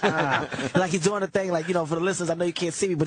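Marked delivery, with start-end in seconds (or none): 0.0-0.5 low, disgusted oh God; disgusted groan. Retold or imitated. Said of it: "He's like".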